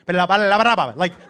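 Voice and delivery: loud mock-Spanish gibberish. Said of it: "my son louder goes".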